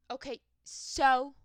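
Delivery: hammed-up unbothered retort, slow and loud; exaggerated so-what. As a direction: stretch "so" into a big challenge.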